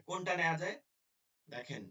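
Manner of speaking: normal talking voice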